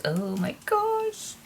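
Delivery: surprised. Real, not acted. Sudden reaction mid-sentence.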